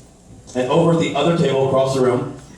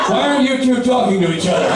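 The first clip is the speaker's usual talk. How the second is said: bartender's outburst; 7 semitones higher than usual. Retold or imitated. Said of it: "He's like".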